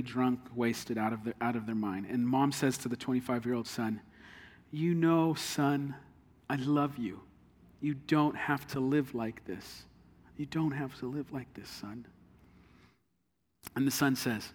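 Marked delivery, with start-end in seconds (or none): 4.7-12.0 soft, pleading mother's voice; a mother gently begging her son to stop; tender mother. Acted.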